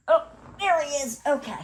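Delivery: quick, high delighted squeal of finding him; light up as the toy turns up; real little cry of relief; relieved surprise. Real, not acted. Finds the lost walrus toy.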